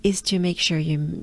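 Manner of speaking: ordinary delivery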